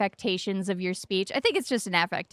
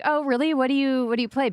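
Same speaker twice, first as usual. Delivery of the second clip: higher-pitched casual curiosity; imitated curious interest; 5 semitones higher, 4 dB louder, faster than usual. Brother asking about streaming, imitated curious interest. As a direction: act out an interested question.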